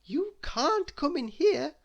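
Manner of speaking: put-on high, slow girlish protest; high, slow plea to keep out; Little Miss Sunshine crying out. A narrator's reading. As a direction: stop him, high and urgent. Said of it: "cried Little Miss Sunshine".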